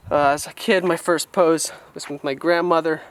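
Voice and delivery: usual speaking voice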